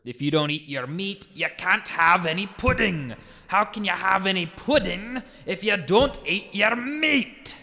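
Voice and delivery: put-on light Scottish accent, scolding a pupil. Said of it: "where the Scottish accent, you hear".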